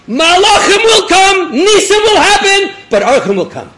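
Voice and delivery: high, loud preaching at full force, easing at the very end; fervent declaration. Acted. Avram vowing to find guests himself, fervent declaration. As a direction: proclaim the vow with everything you have.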